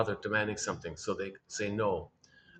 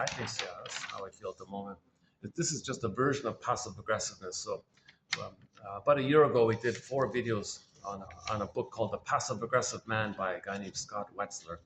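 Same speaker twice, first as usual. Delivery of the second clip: usual speaking voice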